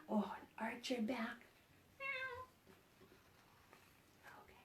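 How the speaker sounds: whisper. Real, not acted. Whispered aside.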